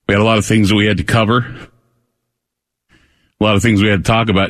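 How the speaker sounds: out of breath, rushing words; breathless after running. Real, not acted. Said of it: "if you're hearing me out of breath".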